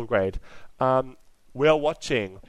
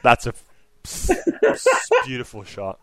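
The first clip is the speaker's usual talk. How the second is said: laughing through the words; shocked laughter; 4 dB louder than usual. Real, not acted. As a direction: break into laughter mid-line. Laughs mid-sentence at the shot.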